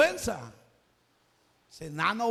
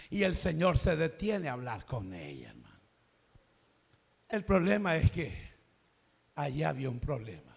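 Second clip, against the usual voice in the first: ordinary delivery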